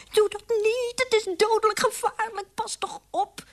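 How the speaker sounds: high desperate begging; high frightened mouse plea; pleading squeak. Acted. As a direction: beg him not to go. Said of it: "smeekte de weduwe".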